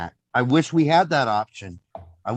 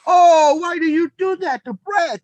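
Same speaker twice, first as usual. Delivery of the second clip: high, slow mock scolding mom voice; a mother's wounded tut-tut, pitched way up; imitating mom; 5 dB louder than usual. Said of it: "And she's like".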